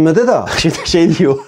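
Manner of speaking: real laugh breaking into the words; laughing in disbelief. Real, not acted. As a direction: laugh it off, exasperated. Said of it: "Vallahi artık gülmek gerekiyor". (inanamayarak gülerek)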